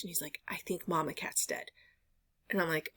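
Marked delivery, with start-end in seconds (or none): 0.5-1.7 whispered like grim news; hushed whisper. Retold or imitated. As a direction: breathe it out softly, no voice. Husband breaks bad news in kitchen.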